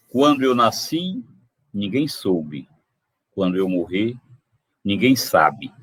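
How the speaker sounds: slow, weighty recital with long pauses; poem recital. Acted. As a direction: let each line land like a verdict. Recita trova anônima. (declamação lenta da trova)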